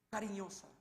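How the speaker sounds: ordinary delivery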